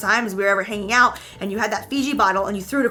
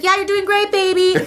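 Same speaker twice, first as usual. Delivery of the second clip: high, louder mock cheering-on; playful high-pitched praise; encouraging boyfriend; 10 semitones higher, 5 dB louder than usual. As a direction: humour her with exaggerated encouragement. Voices boyfriend humouring her.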